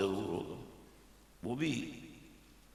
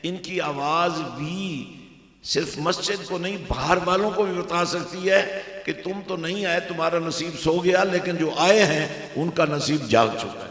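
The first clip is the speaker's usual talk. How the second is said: rousing sermon, raised voice; preach; 5 semitones higher, 8 dB louder than usual. Real, not acted. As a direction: build to loud, forceful preaching. Preaches at full force.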